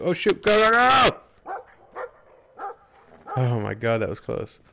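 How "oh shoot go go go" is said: high, loud, drawn-out yell; panicked shout. Real, not acted. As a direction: scream at them to hurry. Close call in game.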